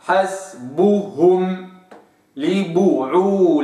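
slow حسبهم لبعولتهن, ب kept; careful tajweed reading; full vowel. Shown as a demonstration. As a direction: recite حسبهم لبعولتهن slowly. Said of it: "هكذا".

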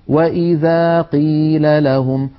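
قيل with a clean light ق, slow and measured; correct qaf. Shown as a demonstration. Teacher models the correct kasra qaf for students. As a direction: say ق in قيل plainly balanced. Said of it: "قاف مكسورة سهلة".

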